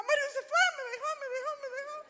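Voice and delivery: high, loud panicked repetition; hammed-up distress, racing thoughts; retold anguish. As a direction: lament being left, pitched right up. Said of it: "qué angustia que sentí".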